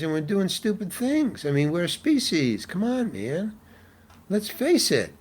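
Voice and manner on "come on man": ordinary talk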